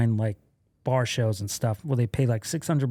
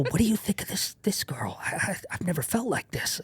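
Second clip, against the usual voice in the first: acted-out confiding whisper; hushed, hesitant heart-to-heart; intimate whisper; 5 semitones higher, 7 dB quieter than usual. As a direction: whisper it like a tender confession. Retold intimate talk about love.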